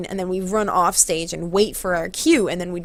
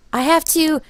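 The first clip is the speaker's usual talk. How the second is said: high, rising incredulous question; puzzled girl imitation; 8 semitones higher, 4 dB louder than usual. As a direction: ask in a high, baffled girl's voice. Retold girl questioning the move.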